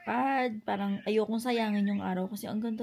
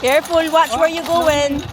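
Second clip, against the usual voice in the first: calling out; 6 semitones higher, 15 dB louder than usual. Real, not acted. Calling out to child walking.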